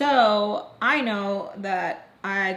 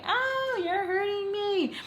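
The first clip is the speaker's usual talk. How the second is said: retold pained cry; 12 semitones higher, much slower than usual. Girlfriend to boyfriend squeezing her thigh, high pained cry. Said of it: "I scream out and say".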